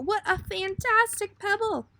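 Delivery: high, bright burst of wonder; delighted exclamation. Acted. Father finding a pebble on the picnic, delighted exclamation. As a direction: marvel out loud at the pebble. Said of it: "he exclaimed".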